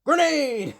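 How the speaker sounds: high, sharp call of grenade; mock battle shout; grenade warning. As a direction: yell it as a warning. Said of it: "the Mandalorian's like".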